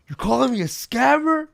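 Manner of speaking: loud, high outraged question; mock indignation. Girlfriend accused of scamming on stream, mock indignant. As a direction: imitate an offended girlfriend, pitch climbing in disbelief. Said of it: "knowing that she's mad".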